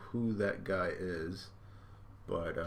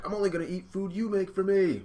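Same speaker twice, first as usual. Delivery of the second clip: much higher, louder yell; high hammed-up yell; yelling Luffy; 12 semitones higher, 6 dB louder than usual. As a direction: shout it at him. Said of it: "when he was yelling at Sanji".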